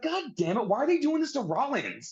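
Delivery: high-pitched retold outrage; exasperated protest. Fan retelling his reaction to Rollins being hit, high-pitched exasperation.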